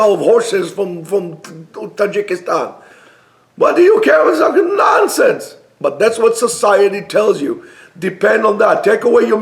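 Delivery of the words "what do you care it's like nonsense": very high-pitched, exasperated dismissal; exasperated outburst. Real, not acted. Preacher dismissing luxury watch features as nonsense, exasperated. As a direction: let the pitch leap up in exasperation.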